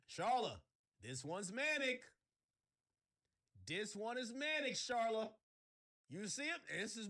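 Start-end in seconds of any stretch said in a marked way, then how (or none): none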